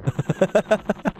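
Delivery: real high chuckle; amused rapid laugh. Real, not acted. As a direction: burst into a short, quick giggle. Laughs at the stereotype joke.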